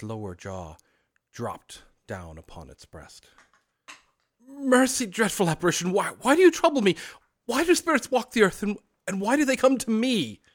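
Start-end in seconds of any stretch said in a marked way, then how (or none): 4.5-10.4 high, loud, trembling pleas; frightened old man. Acted. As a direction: beg in terror, voice high. Scrooge terrified of the ghost.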